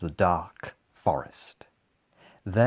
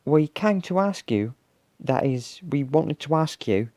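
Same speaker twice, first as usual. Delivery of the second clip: high, hesitant little voice; small creature asking carefully; Basil; 7 semitones higher than usual. A narrator's reading. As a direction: stumble into the request, pitched up. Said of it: "said Basil".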